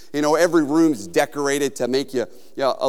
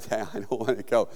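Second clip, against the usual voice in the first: low, soft reluctant mumble; timid refusal; 7 semitones lower, 8 dB quieter than usual. Retold or imitated. Scared student balking at the hole, imitated meek reluctance. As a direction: shrink back from it, half-joking.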